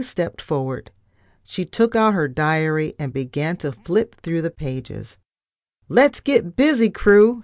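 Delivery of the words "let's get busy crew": loud, high rallying shout. A narrator's reading. Pinky rallies the ship's crew to get busy, shouting. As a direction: call the crew to work loudly. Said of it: "she shouted".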